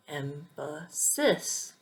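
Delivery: "emphasis" said slowly with the last syllable stressed, em-fuh-SIS; "emphasis" drawn out, landing on SIS. Shown as a demonstration. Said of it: "the correct pronunciation is emphasis".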